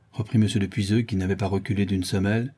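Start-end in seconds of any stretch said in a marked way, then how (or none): none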